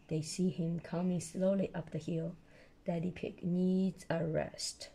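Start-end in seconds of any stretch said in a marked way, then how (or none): none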